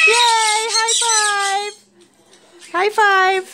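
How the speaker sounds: loud, high cheer for the bird; excited cheer. Real, not acted. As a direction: praise a pet's trick loudly. Cheering bird's high five.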